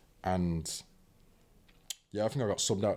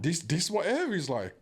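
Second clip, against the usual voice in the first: amazed; 10 semitones higher than usual. Retold or imitated. Amazed at clean Lake District air.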